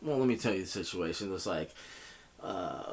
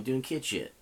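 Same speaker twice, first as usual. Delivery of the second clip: ordinary talk, nothing marked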